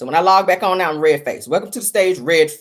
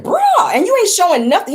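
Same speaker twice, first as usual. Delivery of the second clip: high, loud "bruh" of disbelief; shocked outburst at nothing being shown; incredulous exclaim; 11 semitones higher, 4 dB louder than usual. Retold or imitated. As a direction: exclaim in disbelief, much higher and louder. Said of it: "I said".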